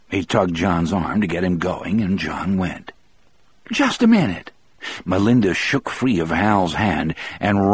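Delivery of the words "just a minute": higher, bright hostess voice; lightly voiced old lady offering tea; cheerful woman. A narrator's reading. Melinda voiced.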